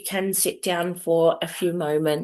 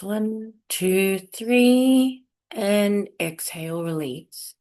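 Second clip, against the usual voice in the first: slow, measured count with long pauses; guided breathing; slower than usual. Acted. Host leads listeners through breaths, guided meditation voice. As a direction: pace each breath for the listener, unhurried.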